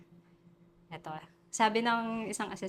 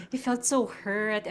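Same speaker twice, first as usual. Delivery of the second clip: ordinary talk, nothing marked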